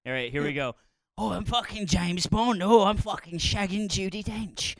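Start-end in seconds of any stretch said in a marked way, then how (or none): 1.2-4.8 aggressive mock British accent, Russell Brand-like; hammed-up angry British bragging; violent British accent. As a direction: swear through it in an over-forceful British accent. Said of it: "The accent is so violent".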